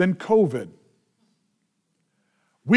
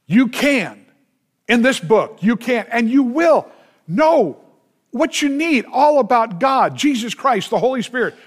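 high, ringing preacher's list; full preaching; 5 semitones higher than usual. Acted. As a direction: drive it home to the congregation. Full-force preaching close.

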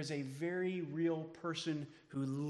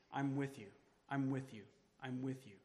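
reassuring; 5 semitones lower, 8 dB quieter than usual. Shown as a demonstration. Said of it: "repeated softer each time".

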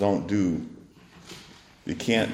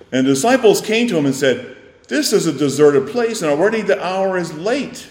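preaching; 8 semitones higher, 6 dB louder than usual. Acted. Sermon at full voice.

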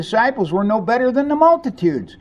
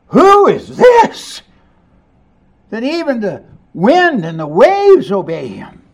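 awestruck; 4 semitones higher, 6 dB louder than usual. Acted. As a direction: gasp it out in amazement. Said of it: "And then they said".

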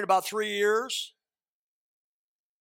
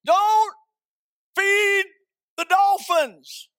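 loud, high, drawn-out slogan; slogan da ripetere; 12 semitones higher, 9 dB louder, much slower than usual. Shown as a demonstration. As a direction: belt out each word slowly for the crowd to repeat. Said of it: "Let's say it together".

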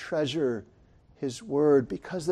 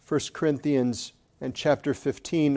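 ordinary talk, nothing marked

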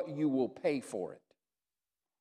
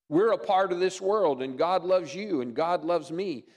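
normal talking voice

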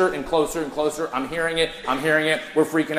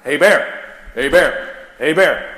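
louder call of "Hey, bear"; 6 dB louder, slower than usual. Retold or imitated. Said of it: "the most important words you say".

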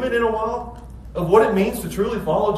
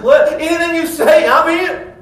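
high, loud, gushing eagerness; eager church member; 8 semitones higher, 7 dB louder than usual. Retold or imitated. Churchgoer telling pastor he's in, gushing eager. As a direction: gush your commitment to the pastor. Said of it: "Mister Hasty walks up to me".

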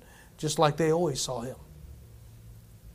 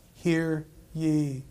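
very slow, grave command; drawn out like a voice from heaven; the Father's voice, slow and solemn; 3 dB quieter, much slower than usual. Acted.

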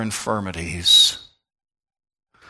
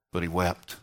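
much softer, low and hushed; 4 semitones lower, 8 dB quieter than usual. Acted. Preacher telling how Jesus wept, hushed and low. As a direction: let the words fall almost silent.

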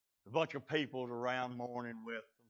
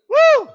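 very high, loud "woo"; piercing preacher's whoop; exultant whoop; 29 dB louder than usual. Real, not acted. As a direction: holler a short whoop after the big shout. Second whoop after his shout.

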